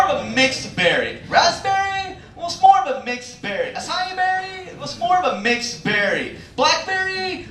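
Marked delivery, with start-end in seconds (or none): 1.3-2.1 high-pitched questioning customer; mock customer asking, pitched high; clueless customer. Imitates customer guessing berries. 3.7-4.7 high-pitched questioning customer; mock customer asking, pitched high; clueless customer. Imitates customer guessing berries. 6.5-7.5 high-pitched questioning customer; mock customer asking, pitched high; clueless customer. Imitates customer guessing berries.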